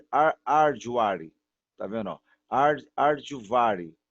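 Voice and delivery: arduare said very slowly with no H and an added vowel at the end; slow mock arduare, ar-du-a-re; wrong pronunciation. Shown as a demonstration. Teacher demonstrating the mistake to students, slow arduare. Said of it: "eu não vou falar isso".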